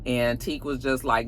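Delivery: ordinary talk, nothing marked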